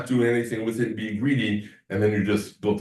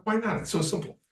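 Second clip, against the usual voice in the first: higher, incredulous retort; mock disbelief; 11 semitones higher, 5 dB quieter than usual. Answering students who never tried the exercise, higher and incredulous. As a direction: pitch it up like you can't believe they skipped it. Retold reply to students not trying.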